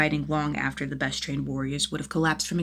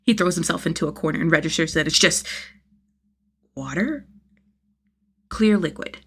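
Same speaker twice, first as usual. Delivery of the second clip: usual speaking voice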